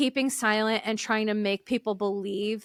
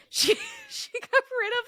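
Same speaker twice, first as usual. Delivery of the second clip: laughing; 14 semitones higher than usual. Real, not acted. Laughing about the couch.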